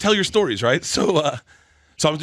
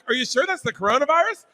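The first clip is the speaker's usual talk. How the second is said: laughing; 7 semitones higher, faster than usual. Retold or imitated. Quoting friend's joke reply, laughing.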